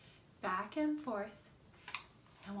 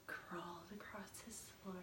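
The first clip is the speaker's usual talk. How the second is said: whisper; 3 semitones lower, 13 dB quieter than usual. A narrator's reading. Sneaking into the sleeping child's room.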